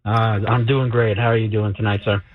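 normal talking voice

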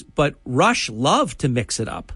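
ordinary talk

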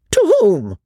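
high, agitated demand; old lady's acted outrage; nervous fury. A narrator's reading. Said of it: "in nervous fury".

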